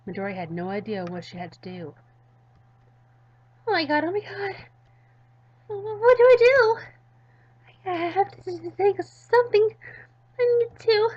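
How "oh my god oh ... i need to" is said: high, loud, stammering panic; panicked girl. Acted. Midori alone with fainted Dev, high frantic panic. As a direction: fumble for what to do, frantic. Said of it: "Midori was starting to get crazy and nervous".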